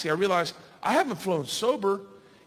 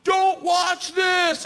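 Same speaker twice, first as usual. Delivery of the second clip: high, slow, stretched-out yell; shouted warning; 9 semitones higher, 5 dB louder, much slower than usual. Retold or imitated. Host warns the comic before jumping, slow high shout. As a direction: draw out every word in a loud shout.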